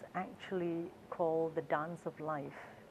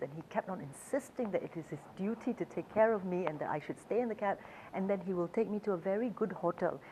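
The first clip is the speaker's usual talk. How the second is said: ordinary talk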